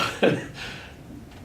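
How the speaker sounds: low-key breathy laugh; amused little laugh; short chuckle. Real, not acted. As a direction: chuckle to yourself mid-story. Recalls his own bad swinging.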